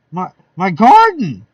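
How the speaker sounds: loud anguished shriek. Acted. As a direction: wail it out over the ruined garden. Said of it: "She fairly shrieked".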